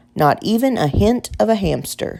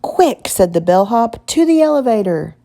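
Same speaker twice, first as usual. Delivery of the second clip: urgent; 6 semitones higher, 3 dB louder than usual. Acted. Bellhop urges escape from spiders.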